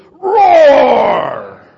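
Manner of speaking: loud high roar; Satan's lion roar. Acted. Preacher voicing Satan, roaring loud like a lion. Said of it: "And Satan goes".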